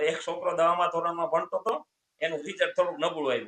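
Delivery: ordinary talk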